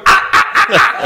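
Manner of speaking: loud, abrupt repeated yells; demon cries. Retold or imitated. Demons driven out by bishop, loud cries. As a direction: yelp in bursts like demons being hit. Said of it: "Demons are going".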